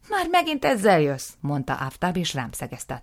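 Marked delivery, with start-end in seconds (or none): 0.1-1.3 high, loud, fed-up groan of words; exasperated. Acted. Aftab exasperated with his brother, high and loud. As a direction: sound utterly fed up.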